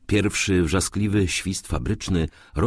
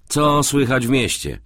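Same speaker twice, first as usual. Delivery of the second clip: mildly hurried, higher-pitched question; a touch of haste; quick question; 9 semitones higher, 4 dB louder than usual. A narrator's reading. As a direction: ask briskly while busy dressing. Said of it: "pytał prędko".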